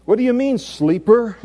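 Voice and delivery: high, loud, incredulous captain; ship's captain rousing sleeper. Acted. Captain finding Jonah asleep in the storm, loud incredulous demand. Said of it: "so the captain comes in there".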